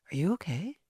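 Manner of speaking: soft, hesitant mock concern; sweet man's concern. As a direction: imitate a gently worried guest, hushed.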